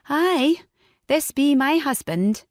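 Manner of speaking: woman posing as a Scot's wife, Scottish brogue. A narrator's reading. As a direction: fake a Scottish brogue to fool the guard. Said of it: "in a Scottish brogue".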